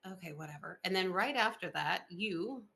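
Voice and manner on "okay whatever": grudging whatever. Retold or imitated. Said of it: "And I'm like".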